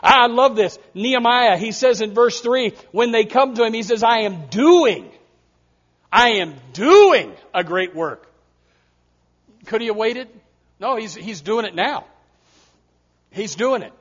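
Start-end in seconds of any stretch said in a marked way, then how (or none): 4.0-8.3 slow, drawn-out and firm; a leader standing his ground; proud declaration. Retold or imitated. Nehemiah refusing his callers, slow and firm.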